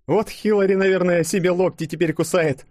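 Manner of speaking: high-voiced, teasing smirk; amused gibe. A narrator's reading. Woman mocking the election loser, amused gibe. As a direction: gloat a little in a high voice. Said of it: "усмехнулась Ёжик".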